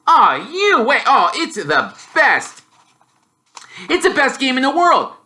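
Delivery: a keen, hammed-up enthusiast; excited. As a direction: rave about his favourite game. Boy raving about Quidditch.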